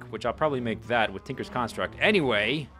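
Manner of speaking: ordinary delivery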